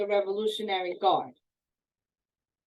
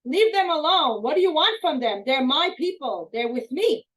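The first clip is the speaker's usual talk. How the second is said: loud, high angry yell; 7 semitones higher, 7 dB louder than usual. Retold or imitated. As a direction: shout him down to protect the family. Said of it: "And he yelled at him".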